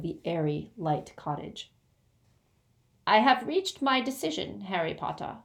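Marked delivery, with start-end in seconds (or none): 3.1-5.4 higher-pitched goblin pronouncement; grave goblin, pitched up. Acted. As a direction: announce a decision as a formal goblin. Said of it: "said the goblin".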